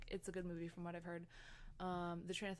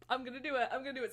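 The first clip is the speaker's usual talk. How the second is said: psyching up; 5 semitones higher, 9 dB louder, much faster than usual. Retold or imitated. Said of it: "And I was like".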